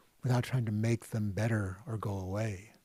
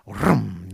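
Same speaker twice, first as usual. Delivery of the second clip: motorbike vroom; 2 semitones lower, 9 dB louder than usual. Retold or imitated. Mind revving like motorbike.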